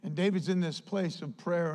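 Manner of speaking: ordinary delivery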